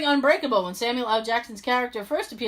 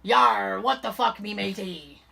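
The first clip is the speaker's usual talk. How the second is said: pirate voice; 5 semitones lower, slower than usual. Retold or imitated. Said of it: "Not as good as my pirate".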